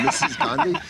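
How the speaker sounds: high, incredulous question with a laugh; amazed. Retold or imitated. A young woman asks, amazed, if her letter goes to Mrs Gandhi. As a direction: ask it in laughing disbelief.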